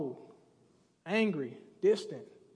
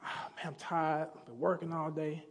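low, quiet, worn-out sigh of a voice, trailing to a breathy near-whisper; tired older son; 5 semitones lower, 8 dB quieter than usual. Retold or imitated. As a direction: drag yourself in after a long workday. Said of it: "this older son is like".

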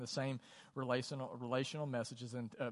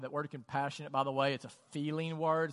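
ordinary talk, nothing marked

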